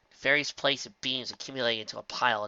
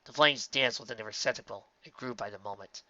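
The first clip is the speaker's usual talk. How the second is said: ordinary delivery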